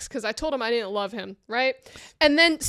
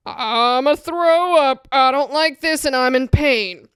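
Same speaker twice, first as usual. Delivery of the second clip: groaning in pain; 7 dB louder, slower than usual. Retold or imitated. Retells feeling nauseous.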